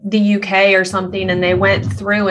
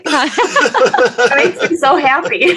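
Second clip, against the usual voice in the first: slow, higher words through laughter; laughing delight; 8 semitones higher, 3 dB louder, much slower than usual. Real, not acted. Guest delighted at hearing her name said differently, laughing. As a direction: say it while cracking up.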